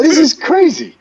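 high, amazed exclamation; retold amazement. Recounting the kids with a police escort, high amazement. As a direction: marvel at it with pitch leaping up. Retells reaction to escorted bus.

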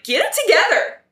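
high, sharp scolding voice of the leader; stern leader scolding. Retold or imitated. Leader scolding the feuding cats, in a high sharp voice. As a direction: snap at them to pull it together. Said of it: "And Blue Star's like".

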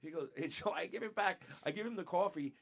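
ordinary talk, nothing marked

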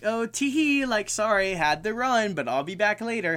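high, lilting mock-coy voice; cutesy note-reading. Reading out the note he left her, cutesy mock-coy. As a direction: play it sweet and giggly, hammed up.

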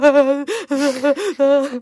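high, wavering mock wail; long, hammed-up noise of panic; imitated panicked wailing. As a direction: babble wordlessly in mock panic. Acting out panicked run to Erik.

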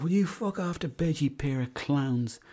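mock scolding, high then slowing down; imitated cross father. Father ordering his sons to bed, imitated scolding. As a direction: send them off in a high mock-angry dad voice. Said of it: "He's like".

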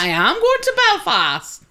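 put-on high-pitched, shaky stab at a Belfast accent; attempted Belfast accent. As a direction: have a bad go at a Belfast accent. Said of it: "Still can't do the accent".

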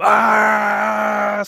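sudden high loud yelp; loud surprised cry; startled shout. Retold or imitated. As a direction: blurt a shocked yell as the noise hits. Party hits him at the door.

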